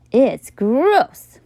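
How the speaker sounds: put-on grossed-out kid voice; disgusted. A narrator's reading. Kid turning away from a sandwich, disgusted. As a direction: pull a face and say it. Kids mocking a lunch.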